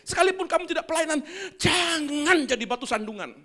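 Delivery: put-on loud exhortation to the children; fervent preaching. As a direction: exhort the young ones. Preaching at full force.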